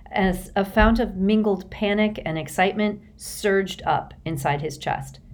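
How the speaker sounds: ordinary delivery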